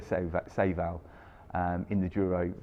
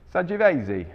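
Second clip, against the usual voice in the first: hammed-up Italian accent, high and loud; 10 semitones higher, 9 dB louder than usual. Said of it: "terrible Italian, um, accent".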